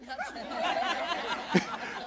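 high airy laugh breaking out; high breathy laugh. Real, not acted. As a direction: laugh at the absurdity. Laughs at own point.

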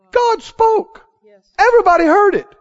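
high, forceful exclamation; amazed proclamation. Real, not acted. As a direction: cry it out as astounding news. Amazed preaching emphasis.

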